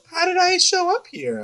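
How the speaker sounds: put-on very high, dazed little girl voice; confused child voice. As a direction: squeak it like a lost twelve-year-old. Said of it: "She's like".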